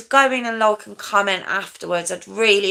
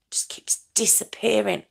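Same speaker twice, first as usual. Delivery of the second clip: whispering under breath; breathy unvoiced mutter; whispered aside; 6 dB quieter than usual. Real, not acted. Muttering about hair aside.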